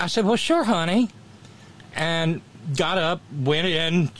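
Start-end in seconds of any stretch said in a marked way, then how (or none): 0.2-1.1 high-pitched, gooey Southern sweet talk; gooey Southern accent. Retold or imitated. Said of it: "my gooey Southern accent".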